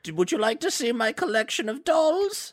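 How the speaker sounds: put-on high, sweet little old lady voice; man doing granny voice. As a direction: play a chatty grandma showing off. Playing the old lady seller.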